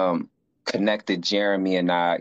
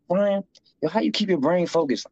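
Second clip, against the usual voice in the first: quoted fellow inmate, pitched much higher; voicing the GED classmate; 10 semitones higher than usual. GED classmate pestering Brian, in a higher voice. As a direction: play the curious young brother asking questions. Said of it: "He would always ask me".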